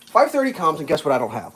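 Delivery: ordinary delivery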